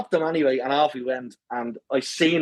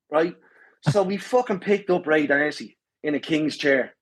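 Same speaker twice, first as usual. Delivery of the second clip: usual speaking voice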